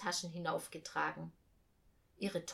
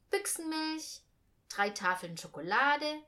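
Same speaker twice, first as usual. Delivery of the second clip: ordinary delivery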